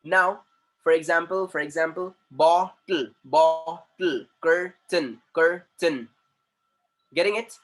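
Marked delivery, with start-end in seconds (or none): 2.3-6.1 "bottle" and "curtain" broken into two separate beats; split into syllables. Shown as a demonstration. Teacher showing the class syllables, "bottle" and "curtain" split in two. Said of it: "cut the word by sound".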